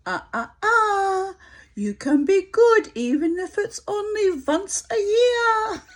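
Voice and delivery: high, loud, slow mock German-accented scold, laughing through it; a faux-Yiddish inner voice wagging a finger in German accent. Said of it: "has a German accent".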